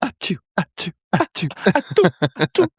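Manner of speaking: tiny, high, cute sneezes; anime sneeze. Retold or imitated. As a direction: squeak out little cartoon sneezes. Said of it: "sneeze like a Japanese schoolgirl in an anime".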